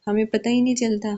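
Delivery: usual speaking voice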